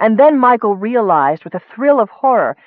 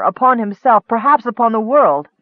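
ordinary delivery

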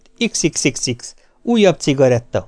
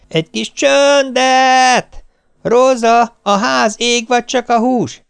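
harried shouting; 8 semitones higher than usual. Retold or imitated. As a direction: shout over the household chaos. Wife shouts at kids, maid.

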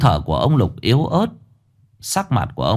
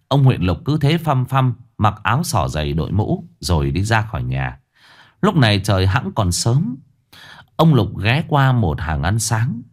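ordinary delivery, nothing marked

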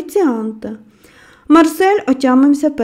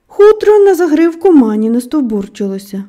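ordinary delivery

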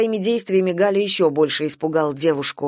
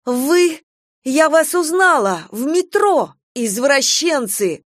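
put-on high, indignant girl's outcry; outraged recognition; 8 semitones higher, 3 dB louder, slower than usual. A narrator's reading. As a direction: exclaim in shocked indignation, drawing out the final word. Girl recognizes her harassers, outraged. (возмущённо, высоким голосом)

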